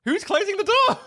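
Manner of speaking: high-pitched, incredulous mock question; mock bafflement. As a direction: squeak it out in comic disbelief. Mock bafflement at film scene.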